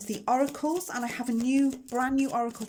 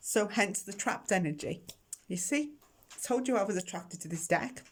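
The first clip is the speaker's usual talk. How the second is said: normal talking voice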